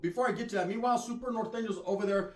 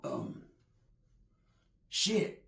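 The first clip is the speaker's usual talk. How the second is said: normal talking voice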